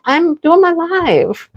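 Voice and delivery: high, slow, drawn-out mock reply; high and slow, a playful protest; her own retorted reply. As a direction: stretch it out, singsong. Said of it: "I'm like".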